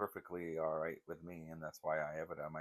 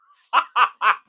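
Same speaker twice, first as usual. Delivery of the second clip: loud laugh; 20 dB louder than usual. Real, not acted. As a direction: burst of high laughter. Laughing at George memory.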